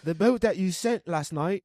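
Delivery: high-pitched, hammed-up British complaint; terrible British accent. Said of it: "terrible British accent".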